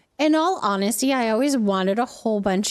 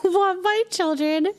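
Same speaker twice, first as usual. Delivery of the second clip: ordinary talk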